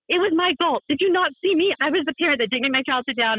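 high, overwrought confession; retold outburst of a panicked parent blaming herself; frantic self-blame. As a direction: re-enact a frantic admission of fault. Retells frantic self-blame after accident.

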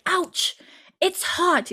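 put-on high-pitched toddler with a Scottish accent; cute little Scottish three-year-old's yelp; Jemmy. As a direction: squeak it like a small Scottish child. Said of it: "so cute in his little Scottish accent".

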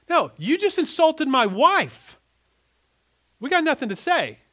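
a husband scolding a friend who insulted his wife, high and loud; indignant anger. Pretend.

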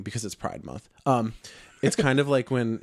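ordinary delivery

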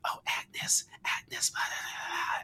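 imitating a killer's creepy whisper; creepy muttering. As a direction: whisper it like a hidden stalker. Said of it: "he's muttering things like".

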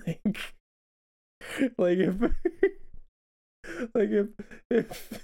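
real giggles breaking up the words; laughing. Real, not acted. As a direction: crack up and keep restarting through laughter. Cracking up at own skit.

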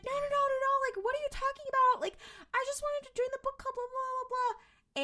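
high-pitched mock protest, rattled off; mock flustered denial. Accused woman denies it to the group, mock flustered. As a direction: play a squeaky, hurried denial. Voices Tammy denying the accusation.

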